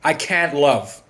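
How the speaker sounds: slow, drawn-out quoted line; mock ex-wife, slowed right down; quoting the ex-wife. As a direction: let each word hang, cold. Ex-wife's words quoted slowly.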